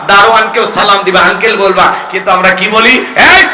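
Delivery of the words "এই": high, loud scolding "hey"; scolding call. Acted. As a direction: call out sharply to scold someone. Acts out scolding people's dismissive call.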